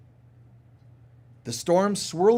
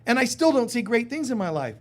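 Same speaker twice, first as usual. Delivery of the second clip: higher, louder mock protest; mock protesting student; 6 semitones higher, 4 dB louder than usual. Defensive listener protesting to the teacher, higher mock whine. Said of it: "some of us go".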